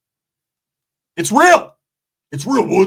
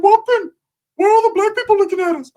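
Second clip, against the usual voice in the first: much higher, louder goofy cousin voice; cousin Ricky's voice; 4 dB louder than usual. Retold or imitated. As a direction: squeak it out like a clueless stoner cousin. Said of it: "he's like".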